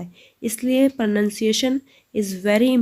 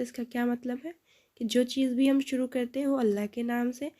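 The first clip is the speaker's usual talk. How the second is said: usual speaking voice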